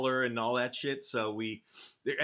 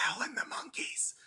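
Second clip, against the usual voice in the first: hushed, high whispery voice; little inner voice; 9 semitones higher, 8 dB quieter than usual. Retold or imitated. Band member imitating the voice that named the group, high whisper. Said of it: "this little voice in my head".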